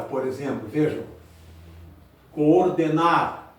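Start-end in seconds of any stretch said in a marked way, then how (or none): 2.3-3.5 coordenar said very slowly, co and or split; slow syllables. Shown as a demonstration. Teacher to class, drawing out coordenar slowly. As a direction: stretch coordenar so both Os ring. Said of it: "dois Os".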